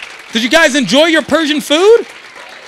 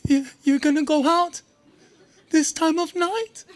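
imitating his dad; 4 semitones higher, 5 dB quieter than usual. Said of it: "I'm like".

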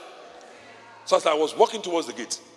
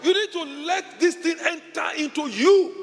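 fiery preaching; 13 semitones higher than usual. Real, not acted. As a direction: shout it over the congregation. Preaching at full force.

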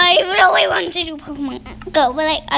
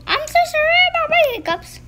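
much higher silly voice; squeaky high voice; 12 semitones higher than usual. Acted. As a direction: squeak the apology. Squeaky apology.

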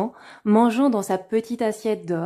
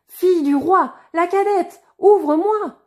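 much higher, loud sing-song call; insistent higher call; 8 semitones higher, 6 dB louder than usual. A narrator's reading. Frog repeating its call at the door, higher and louder. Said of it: "la voix appela".

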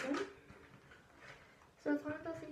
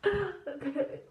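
laughing; 8 dB louder than usual. Real, not acted. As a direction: burst of laughter. Laughing at herself.

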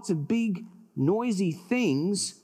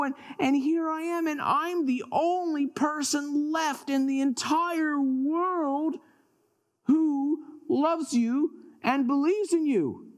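high, pleading protest; aggrieved complaint; 8 semitones higher than usual. Acted. Elijah in the cave complains to God, aggrieved and high. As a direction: pour out grievances, pitched high.

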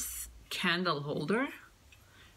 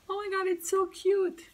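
excited squeal; 12 semitones higher, 4 dB louder than usual. Real, not acted. Gushing over a toy shop.

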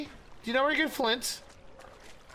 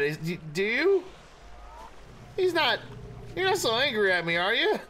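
ordinary talk

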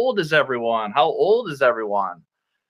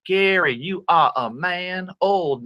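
usual speaking voice

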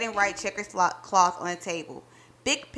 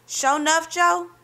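higher, louder astonished reply; can't believe it's true; surprised disbelief; 8 semitones higher, 5 dB louder than usual. Acted. Wife amazed at husband's plan, higher and louder. Checks if husband plays a prank.

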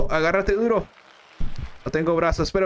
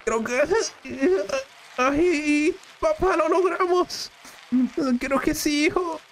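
high, relieved cheer; breathless relief; relieved joy; 9 semitones higher than usual. Acted. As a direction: celebrate surviving. Son and father relieved, high.

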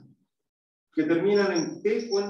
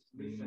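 humming m; 11 semitones lower, 15 dB quieter than usual. Shown as a demonstration. Teacher hums "mm" to show the nasal sound. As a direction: hum "mm" with the lips closed. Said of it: "Hagan ese sonido".

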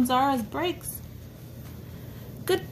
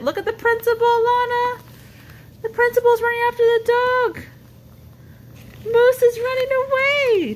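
much higher and louder, rushing; excited; 11 semitones higher, 5 dB louder, faster than usual. Real, not acted. Parent describing the principal chasing the dog, excited, high and loud. Parent shows toddler the chase scene.

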